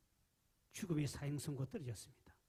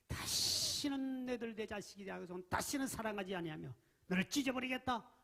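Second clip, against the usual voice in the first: angry God; 7 semitones higher, 3 dB louder than usual. Acted. God's angry words voiced.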